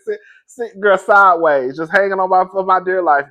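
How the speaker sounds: ordinary talk, nothing marked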